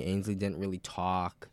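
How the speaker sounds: normal talking voice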